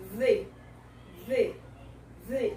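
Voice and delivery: 'V' as voiced th plus ee; 'V' as an isolated, clear 'thee'; 'the' with I sound. Shown as a demonstration. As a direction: say 'V' as 'thee', tongue between the teeth. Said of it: "trazer pro som do I".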